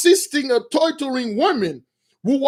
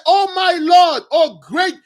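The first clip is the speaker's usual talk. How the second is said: put-on desperate girl begging the prince; screaming plea; 6 semitones higher than usual. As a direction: cry out for mercy from the ground. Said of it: "she said".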